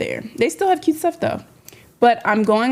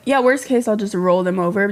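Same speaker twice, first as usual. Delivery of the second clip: ordinary talk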